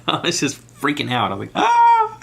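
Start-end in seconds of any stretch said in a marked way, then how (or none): 1.5-2.2 piercing high shriek at full volume; fangirl scream. Retold or imitated. Seeing [name] live, a fan's piercing shriek. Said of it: "Screaming like one of those girls".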